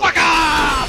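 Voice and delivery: high, urgent yell; real shout to drive it away; alarmed yell. Real, not acted. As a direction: yell it at an attacker. Yelling at attacking spider.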